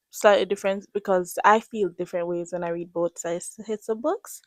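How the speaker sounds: normal talking voice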